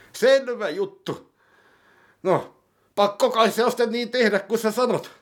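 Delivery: high character voice. Acted. (kohotettu hahmoääni)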